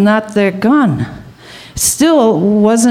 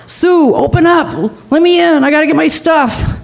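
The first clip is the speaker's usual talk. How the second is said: retold door-pounding demand; 8 semitones higher than usual. Jack demanding entry at Sue's door, mock holler. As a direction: imitate him calling out to be let in, pitched up.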